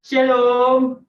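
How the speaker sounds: lightly intoned like a priest; priestly greeting. Acted. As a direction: greet the flock like a priest, loud. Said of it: "Udah kayak Romo ya?". (salam seperti romo)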